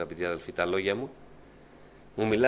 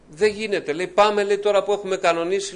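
much higher and louder, urging; acted insistent protest; 10 semitones higher, 6 dB louder than usual. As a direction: protest loudly in a high, pressing voice. Acted protest over cancelled plans. (έντονη διαμαρτυρία με ψηλή, δυνατή φωνή)

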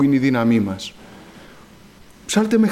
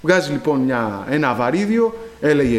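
ordinary delivery

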